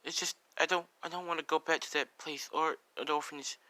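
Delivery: put-on high, soft begging boy; tearful pleading. Boy begs to stay till the rain stops, acted tearful pleading. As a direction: plead like an upset child, without real sobs. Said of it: "he's crying, tears of".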